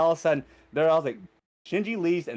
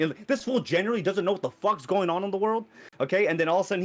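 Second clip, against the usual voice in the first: normal talking voice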